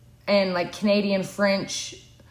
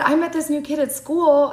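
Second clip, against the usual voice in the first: brother quoted; 7 semitones higher, 3 dB louder than usual. Retold or imitated. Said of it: "he said".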